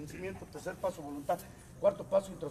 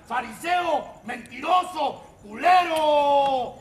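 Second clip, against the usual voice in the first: shouted accusation; 5 semitones higher, 8 dB louder, much slower than usual. Pretend. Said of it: "grita desde la silla".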